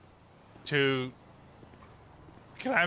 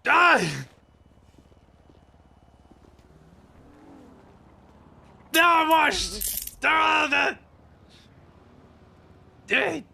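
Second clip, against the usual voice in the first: shouting in a scuffle; yelling; 12 semitones higher, 8 dB louder, much slower than usual. Real, not acted. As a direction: yell it at full voice. Tackle scuffle.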